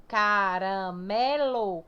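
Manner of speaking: "caramelo" stretched out syllable by syllable; very slow, deliberate ca-ra-me-lo; split into syllables. Shown as a demonstration. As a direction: break "caramelo" into long separate syllables, leaning on ME. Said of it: "Qual é a mais forte?".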